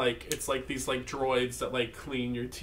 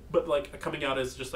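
normal talking voice

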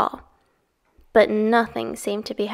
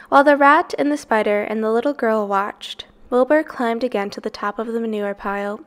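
ordinary delivery, nothing marked

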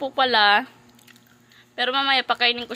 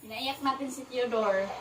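ordinary delivery

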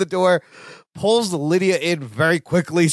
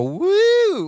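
high imitated crowd whoop; audience whoop; 14 semitones higher than usual. Co-host imitates the audience at the dress reveal, high whoop. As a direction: mimic a whooping studio audience.

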